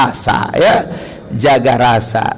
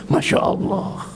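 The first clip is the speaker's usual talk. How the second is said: hushed awe; 4 semitones higher, 7 dB quieter than usual. Real, not acted. Hushed awe after cry.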